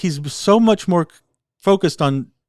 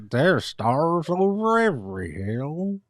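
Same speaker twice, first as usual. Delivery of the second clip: slow, softer, grave reading of "There's stars over every hill"; solemn, slow pronouncement; 5 dB quieter, slower than usual. Retold or imitated. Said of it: "But then he's like".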